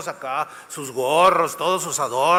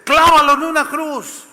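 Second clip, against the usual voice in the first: loud, much higher furious shout; crowd demanding; 10 semitones higher, 7 dB louder than usual. Acted. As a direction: bellow it like an angry mob.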